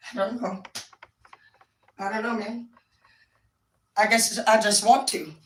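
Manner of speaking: ordinary talk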